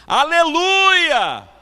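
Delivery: high, very long-drawn holler; belted, stretched-out cry; full-force shout. Retold or imitated. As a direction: shout it out slowly to a whole hall. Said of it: "parou um pouquinho e gritou de novo".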